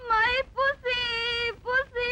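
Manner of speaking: sudden crying. Acted. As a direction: burst into hammed-up sobbing. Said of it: "திடீர்னு ஏன் அழுவுறீங்க?".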